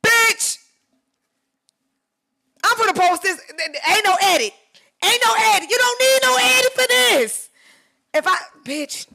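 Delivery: high, loud thrilled shouts; bragging yells about her unedited shot; excited yelling. Real, not acted. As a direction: hype it up at full volume. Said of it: "I'm yelling and screaming".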